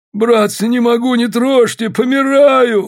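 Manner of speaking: high, strained dying plea; croaking plea. A narrator's reading. Injured Попов begging not to be touched, high strained plea. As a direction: wail it high, dragging the last word. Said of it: "хрипел Попов".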